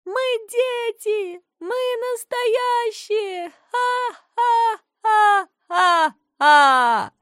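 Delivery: high, slow, distant echo with mocking laugh. A narrator's reading. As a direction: let it ring far off. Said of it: "их снова нагнало эхо".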